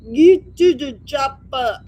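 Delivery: loud, slow Arnold shout. Retold or imitated. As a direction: bark it like an action hero. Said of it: "I heard Arnold say".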